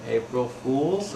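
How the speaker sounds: very slow, drawn-out words; sheepish prank reveal. Retold or imitated. Pranksters confess to crushed bandmates, slow drawn-out reveal. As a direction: stretch it out tentatively.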